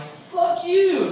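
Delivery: high, loud fuck you; mock comeback. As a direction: fire it right back at the heckler. Comic snaps back at heckler.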